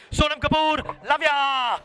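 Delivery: bright, loud, high-pitched send-off; excited. Real, not acted. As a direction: call it out with a big grin. Host's loud warm sign-off to guest.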